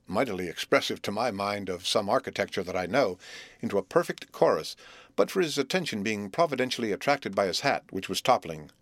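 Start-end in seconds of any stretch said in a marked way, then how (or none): none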